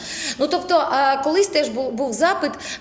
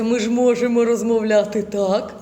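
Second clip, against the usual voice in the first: low, raised palate; 3 semitones lower than usual. Shown as a demonstration. Teacher shows students a low, domed voice with raised palate. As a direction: voice pushed down with a lifted palate. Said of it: "весь свій голос зосередила отут, я його опустила".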